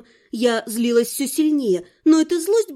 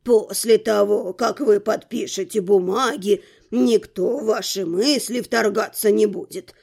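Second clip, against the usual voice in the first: put-on low, even old man's voice; reassuring old rector; 5 semitones lower than usual. A narrator's reading. Rector promises her a mental block, reassuring. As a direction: explain calmly in a deep register.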